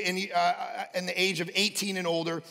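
ordinary talk

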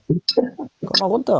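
very slow, drawn-out calm down; slow, soothing plea to cool off. Real, not acted. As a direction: stretch each word to settle him.

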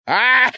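sudden high cry of fright; a real jump-scare yelp; startled yelp. Real, not acted. Startled in the dark.

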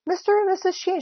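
high-pitched cry on waking; high flustered greeting; startled cry. A narrator's reading. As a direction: cry out as if caught. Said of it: "she cried".

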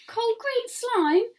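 high slow disgusted question; groaning boy. A narrator's reading. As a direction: recoil at the slime. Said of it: "groaned Jack".

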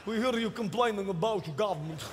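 put-on low, menacing Polish accent; mock-ominous Polish revolutionary. Polish people acted plotting revolution.

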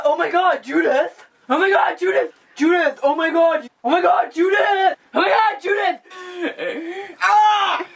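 frantic high-pitched screams of rage; hysterical screaming. Acted. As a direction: lose it completely, shrieking. Boyfriend stabs cheating girlfriend.

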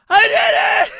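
high, loud cry of victory; triumphant shout. Retold or imitated. Kobe after beating Full Body, shouting high. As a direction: cheer like you can't believe you won. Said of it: "And Kobe's just like".

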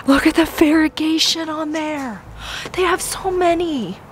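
thrilled high gush over the plants; excited. Real, not acted. Plant lover gushing to her viewers, excited and high. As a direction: voice jumps up in delight.

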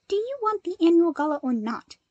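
a hint of fake offense; mock offended. A narrator's reading. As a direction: pretend to be put out, teasing. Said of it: "with a mock sniff".

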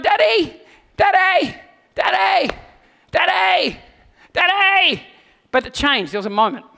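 high, loud repeated call; kids calling Daddy. Retold or imitated. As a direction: call for Daddy across the park. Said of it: "They're going".

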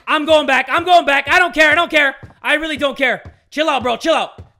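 high, loud, repeated protests; panicked outburst. Real, not acted. Panics and retreats in game.

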